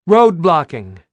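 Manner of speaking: high, loud report; shouting over noise. Acted. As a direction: shout over the roar of a Raven. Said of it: "Anya was shouting over the noise".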